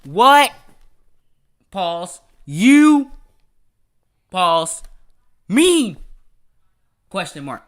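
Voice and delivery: exaggerated stop-start question, loud words and long gaps; mock someone who halts after every word of "What You Mean"; mock pausing. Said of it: "so this how you talk".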